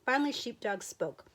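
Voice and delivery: ordinary delivery, nothing marked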